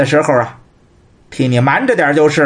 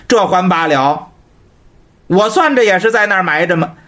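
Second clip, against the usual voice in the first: normal talking voice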